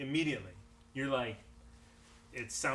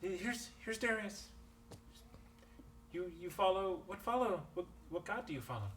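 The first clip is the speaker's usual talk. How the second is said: villager voice; 6 semitones higher, 6 dB quieter than usual. Acted. GM voices villager asking cleric.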